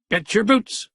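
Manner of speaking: hint of a spry old man; lightly voiced old Gramp. A narrator's reading. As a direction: play the feisty grandfather. Gramp's voice in the story.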